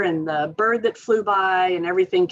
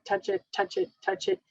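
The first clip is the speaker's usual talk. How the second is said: higher, softer repeated touch it; playful repeated touch it; sing-song repetition; 11 semitones higher, 6 dB quieter than usual. Shown as a demonstration. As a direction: chant it rhythmically like a child playing.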